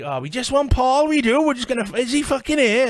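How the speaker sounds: put-on very high, loud Glasgow street accent. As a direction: shout it like a Glasgow hard man. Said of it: "they were like".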